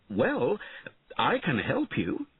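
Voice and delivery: a hint of a cocky knight; sly helpful knight. A narrator's reading. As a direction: pitch it up, bargaining lightly. Knight offers help for money.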